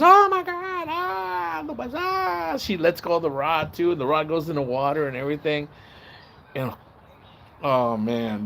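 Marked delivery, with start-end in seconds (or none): 0.0-2.6 much higher, crying out in pain; panicked cry. Retold or imitated. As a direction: wail like the stung man. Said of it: "he starts screaming loud".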